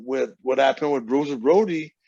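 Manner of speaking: usual speaking voice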